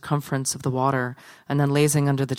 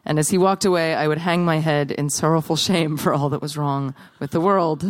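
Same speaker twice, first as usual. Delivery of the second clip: usual speaking voice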